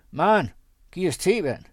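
a loud, high call through the kitchen door; shouted. Acted. Pastor calls to his wife through the kitchen door, loud and high. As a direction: holler it into the house. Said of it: "råbte han ud af køkkendøren".

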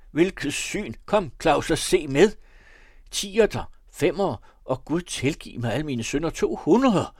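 high, loud bursts of delight and disbelief; astonished shouting. A narrator's reading. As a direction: call out excitedly as you count the money. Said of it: "råbte han".

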